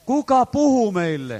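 drawn-out loud plea; crying out. Retold or imitated. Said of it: "Tätä he huutavat ja kysyvät".

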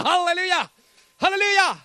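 high, loud joyful cry of halleluja; ecstatic halleluja shout. Real, not acted. Preacher to congregation, ecstatic loud halleluja shout.

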